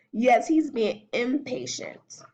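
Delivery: ordinary delivery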